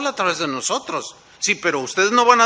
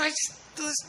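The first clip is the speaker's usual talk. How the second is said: much higher but softer, trailing off; fed-up Moses; 11 semitones higher, 10 dB quieter than usual. Retold or imitated. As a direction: give up mid-sentence, exasperated.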